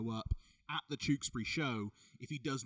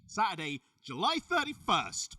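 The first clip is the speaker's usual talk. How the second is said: announcer hype; 7 semitones higher, 9 dB louder than usual. Pretend. Host announcing the show date to viewers, booming hype. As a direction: stretch the date like a ring announcer. Promo read to camera.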